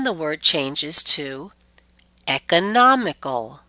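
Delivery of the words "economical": "economical" with NOM punched and lengthened; stress before suffix. Shown as a demonstration. Teacher shows the changed word, "economical" stressed on NOM. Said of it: "we stress right before it".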